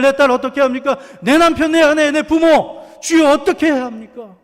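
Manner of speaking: a preacher acting out a desperate prayer; pleading prayer cry. Shown as a demonstration. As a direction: pour out every grief to the Lord. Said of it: "이렇게 해야 하는 것 아닙니까?".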